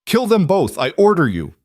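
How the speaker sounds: high, frantic shrieked order; screaming. A narrator's reading. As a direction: shriek it, losing control. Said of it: "he screamed at his guards".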